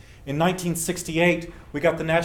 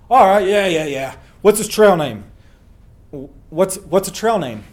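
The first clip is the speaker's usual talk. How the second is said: louder, impatient hostel owner; a hint of a gruff Crazy Larry; 6 dB louder than usual. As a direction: hurry the caller along, loud and fast. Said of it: "I'm doing my best Crazy Larry voice".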